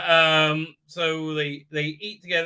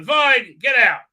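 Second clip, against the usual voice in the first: loud, higher mock bark; exasperated mock order; angry dismissal; 6 semitones higher, 9 dB louder, slower than usual. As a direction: throw them out with a loud, short order.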